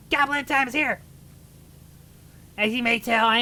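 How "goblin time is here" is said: goblin voice. Acted. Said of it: "For the voice, it's goblin time".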